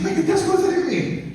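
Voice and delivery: very high, loud disbelieving husband; acted incredulous squawk. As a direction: throw it back at her in bafflement. Husband baffled by wife's plan.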